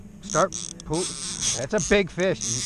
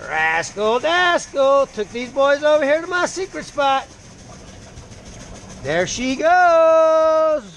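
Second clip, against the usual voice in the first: higher, louder boastful showman; hyped bragging; 7 semitones higher, 4 dB louder, slower than usual. Real, not acted. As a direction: brag to the camera, big finish. Bragging to camera after catch.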